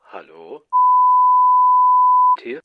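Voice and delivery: normal talking voice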